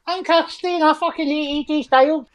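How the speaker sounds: very high put-on rant as Kirsty; wee hairy voice. Podcaster mocking Kirsty's wrestling opinions, high wee voice. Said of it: "wee, wee hairy voice".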